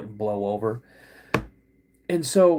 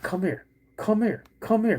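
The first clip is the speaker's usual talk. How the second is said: higher-pitched mock angry summons; through gritted teeth; 8 semitones higher than usual. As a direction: imitate a fuming dad through gritted teeth. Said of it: "He's gritting his teeth".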